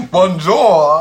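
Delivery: loud mock-French bonjour; a hammed-up French Bonjour. Joking she speaks French to her friend, mock French greeting. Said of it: "it's like I'm speaking French".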